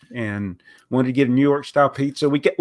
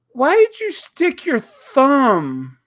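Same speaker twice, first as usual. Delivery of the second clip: high, slow disbelief; incredulous; 8 semitones higher, much slower than usual. Retold or imitated. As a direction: stretch out the disbelief, higher. Retold complaint to waitress.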